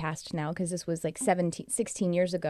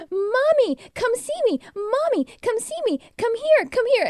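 very high, loud, plaintive bird voice calling Mommy; imitating bird's cry; 5 dB louder than usual. A wife voices her cockatiel calling for her, very high and pleading. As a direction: squeal it like a lonely cockatiel begging. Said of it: "she's going".

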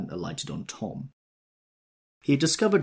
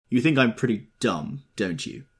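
suggesting; 4 semitones higher, 6 dB louder than usual. A narrator's reading. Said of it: "he suggested".

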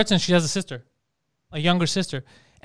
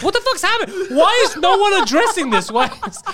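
high, laughing exasperated protest; baffled outrage; 8 semitones higher than usual. Acted. Season-long viewers demand an explanation, exasperated. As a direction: plead in high-pitched disbelief.

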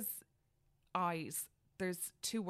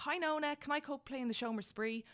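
put-on high childlike voice; child voice; 9 semitones higher than usual. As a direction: voice a little boy asking. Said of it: "Tadhg will be like".

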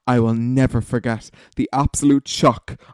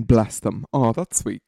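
ordinary delivery

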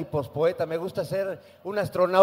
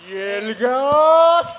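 long, high, loud vendor cry; gas truck call; 6 semitones higher, 10 dB louder, much slower than usual. Retold or imitated. (grito del camión del gas, agudo y alargado)

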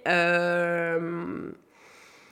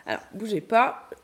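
ordinary talk

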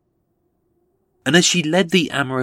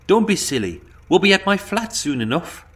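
higher, lightly voiced gentle Irish lilt; a hint of a soft Irish accent, pitched up; soft Irish brogue; 8 semitones higher than usual. A narrator's reading. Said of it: "in her soft Irish brogue".

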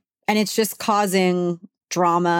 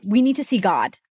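ordinary talk, nothing marked

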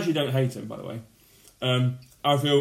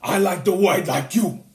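higher, slow, drawn-out star turn; Al Pacino impression; 5 semitones higher, 3 dB louder, slower than usual. Answering the favourite kit question, slow Pacino impression. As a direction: stretch it like an overacting American star. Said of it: "as Al Pacino".

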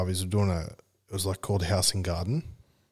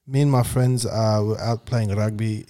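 slow South African rugby-lad accent; slow, mild South African; slower than usual. Shown as a demonstration. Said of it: "I kinda hear it".

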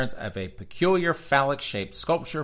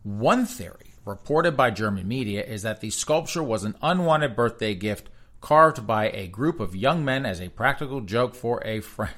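ordinary delivery

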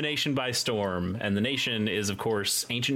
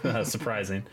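ordinary talk

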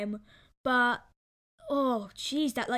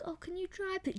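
mock polite request; 8 semitones higher, 9 dB quieter than usual. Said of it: "I'm not gonna start being like".

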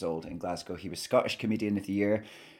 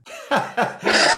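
high, loud burst of laughter; 14 semitones higher, 11 dB louder than usual. Real, not acted. Laughs at the insult.

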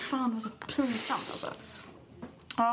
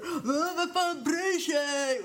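high, slow careless drawl; mock dismissive; 7 semitones higher, much slower than usual. Friends at the party mocking, high and slow. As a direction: brush it off like drunk friends.

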